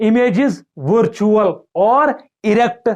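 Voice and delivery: slow dictation. Shown as a demonstration. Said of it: "हम बोलेंगे".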